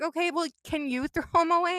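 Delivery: retold exasperation, pitched high; exasperated request. Retelling her plea to the donation worker, exasperated.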